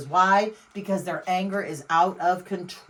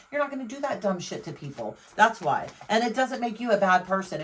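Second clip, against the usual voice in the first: normal talking voice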